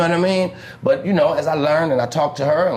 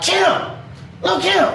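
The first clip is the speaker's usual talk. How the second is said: Kim yelled far higher, insistent; angry calling out; slower than usual. Retold or imitated. Angry pimp yelling his woman's name from the car. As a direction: call her name hard so she turns.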